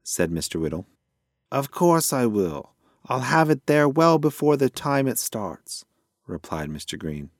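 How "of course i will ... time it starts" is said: lightly voiced obliging grocer, pitched higher; a higher, agreeable shopkeeper, lightly acted. A narrator's reading. Grocer assures a customer about delivery, lightly voiced and agreeable. Story figure grocer replying.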